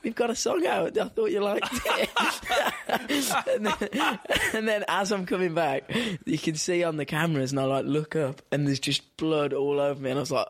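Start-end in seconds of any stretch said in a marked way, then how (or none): 0.0-5.9 much higher, talking through laughter; laughing. Real, not acted. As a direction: crack up while telling the story. Laughing at his own bloody gig.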